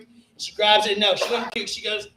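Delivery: usual speaking voice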